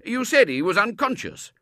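very loud, high exclamation; blustering disbelief; astonished Colonel. Acted. Colonel insisting he saw Robert, loud and astonished.

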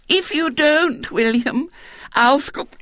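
put-on high, slow, lisping child threat; threatening little girl. A narrator's reading. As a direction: warn him as a spoilt small girl. Tearful girl threatens to scream.

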